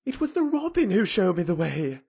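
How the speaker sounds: put-on loud, high girl's insistence; obstinate protest. A narrator's reading. Mary answering Ben's demand, obstinate protest. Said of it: "she protested obstinately".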